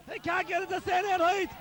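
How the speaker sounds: ordinary talk, nothing marked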